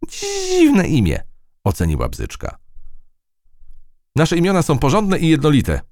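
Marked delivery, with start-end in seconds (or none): 0.1-1.2 very high, slow, drawn-out first sound; buzzing fly. Acted. Fly judges the new name, buzzing high. 4.1-5.8 lightly voiced high ant soldier; declaring. A narrator's reading. Ant soldier defends ant names, declaring. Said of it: "oświadczyła W trzysta trzydzieści trzy".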